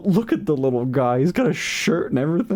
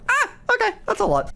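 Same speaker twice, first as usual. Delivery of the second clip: surprised; faster than usual. Retold or imitated. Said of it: "And I was like".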